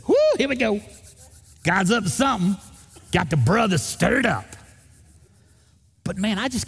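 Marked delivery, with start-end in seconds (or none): 0.1-4.4 high, eager whoop of anticipation; excited. Retold or imitated. Pastor relishing church trouble, excited and whooping high. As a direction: cheer it like a fan before the game. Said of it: "I'd get excited".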